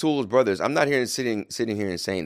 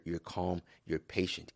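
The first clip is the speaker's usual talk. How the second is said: normal talking voice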